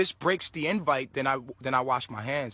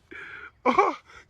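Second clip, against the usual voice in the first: high laugh; 13 semitones higher, 4 dB louder than usual. Real, not acted. Laughing at the admin's request.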